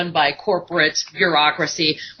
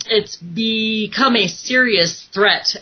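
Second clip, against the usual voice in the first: usual speaking voice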